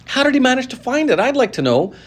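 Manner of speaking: put-on jealous big boy's outburst; a grudging boy yelling; shouted angrily. A narrator's reading. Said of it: "a large boy shouted angrily".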